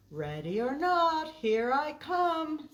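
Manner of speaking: loud hide-and-seek call, last words slowed. Acted. Oliver calls to his friends playing hide and seek, loud and drawn out. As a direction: call it out across the playground. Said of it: "said Oliver".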